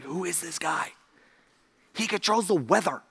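very soft, hushed amazement; awed hush. Retold or imitated. Disciples nudging each other about Jesus, awed hush. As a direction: say it under your breath in wonder.

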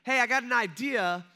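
high, loud call to a boat; mock shout across the water. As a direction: holler a helpful suggestion from shore. Stranger calling out to fishermen.

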